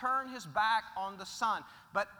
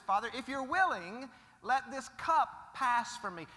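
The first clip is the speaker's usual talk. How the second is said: fervent plea; 5 semitones higher, 5 dB louder than usual. Acted. Preacher voicing Jesus begging the father, fervent. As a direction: cry out the prayer with urgency. Prayer voiced again louder.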